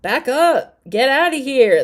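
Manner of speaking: loud, high mock yell; hammed-up shout telling someone to back off; Destiny imitation. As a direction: bark it out like a fed-up girlfriend. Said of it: "that sounded like destiny".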